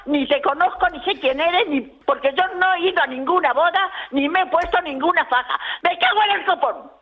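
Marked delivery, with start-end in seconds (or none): none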